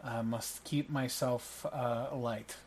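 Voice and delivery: soft, hesitant polite father figure; courteous Ravi declining. Acted. Ravi turns down extra food from a host, soft and courteous. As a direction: decline politely, quietly and haltingly. Game master plays Ravi.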